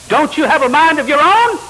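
high, loud mock challenge; challenging question. Challenger questioning a churchgoer's obedience, high loud challenge.

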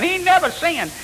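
high, indignant mock insistence; Willis Walker. Seminary student refusing to give in, high heated protest. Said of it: "I thought they was gonna have a fight".

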